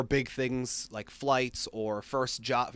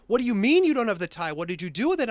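high, fast, louder retort; incredulous demand; 9 semitones higher, 5 dB louder, much faster than usual. Retold or imitated. Friend confronts him over the missing tie, incredulous. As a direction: fire off the questions in disbelief. Friend's alarmed reaction, retold.

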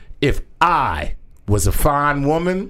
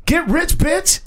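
high, light rapper imitation; a playful nod to a rapper; [name] impression; 14 semitones higher, slower than usual. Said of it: "[name] knows the deal about America".

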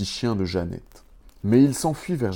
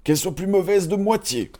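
dwarf curse; 10 semitones higher than usual. A narrator's reading. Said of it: "dit le sixième".

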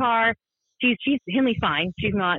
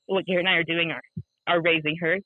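normal talking voice

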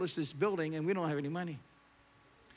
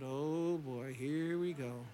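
low, resigned aside; dreading inner thought; 6 semitones lower, 5 dB quieter, slower than usual. Retold or imitated. Preacher seeing the businessman approach, low retold dread. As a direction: brace for bad news under your breath. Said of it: "and I thought".

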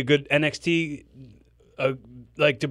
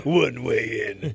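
deep trailer voice; 4 semitones lower, slower than usual. Shown as a demonstration. Trailer tagline voice.

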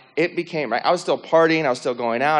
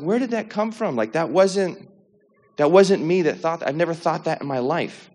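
retold bafflement, pitched up; puzzled, higher-voiced disbelief at your own thought; weirded out; 5 semitones higher than usual. Telling an audience about hearing answers in his head, weirded out. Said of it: "weirded out".

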